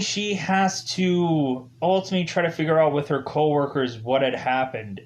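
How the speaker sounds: ordinary talk, nothing marked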